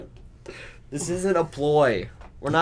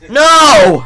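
sudden loud protest; loud shout; 12 semitones higher, 16 dB louder than usual. Real, not acted. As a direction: yell it out in alarm. Friend drops the roll.

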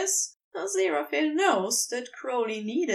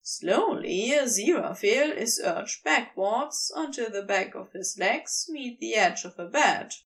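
plain reading voice

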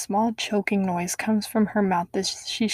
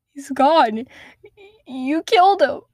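anguished reveal; 7 semitones higher, 5 dB louder than usual. Acted. Mother revealing the killing.

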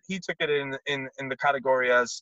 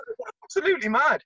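mock coach reply, high; 12 semitones higher than usual. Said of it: "He goes".

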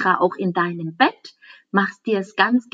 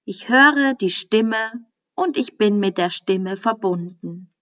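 guided meditation. Acted. Teacher guiding a listener into Yoga Nidra, calm meditation voice. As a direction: ease the listener into stillness, unhurried.

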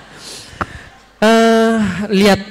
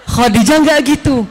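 emphatic praise; 7 semitones higher than usual. Real, not acted. Preacher exclaims Khadijah's devotion.

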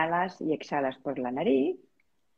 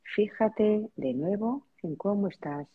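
spacious, measured relaxation-practice voice; guided meditation. Real, not acted. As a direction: lead them gently into noticing their breath, unhurried. Leads a breathing meditation.

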